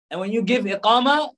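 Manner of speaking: ordinary talk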